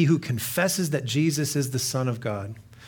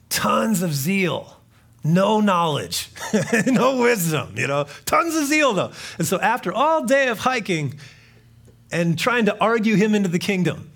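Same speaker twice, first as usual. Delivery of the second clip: climbing, louder preaching retelling; rising preacher fervor; 7 semitones higher, 5 dB louder than usual. Acted. Preacher recalling his zealous youth, fervent. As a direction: build the story with mounting zeal.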